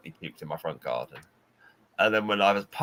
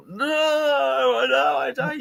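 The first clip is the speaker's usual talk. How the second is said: much higher, desperate pleading no; pitiful whine; 4 dB louder, much slower than usual. Retold or imitated. Sick Tom begging Eddie to stop talking about food. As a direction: whimper it like you'll be sick.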